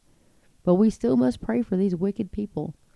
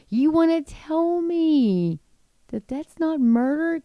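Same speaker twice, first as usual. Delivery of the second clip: incredulous challenge; 8 semitones higher, slower than usual. Real, not acted. Indignant challenge to viewers.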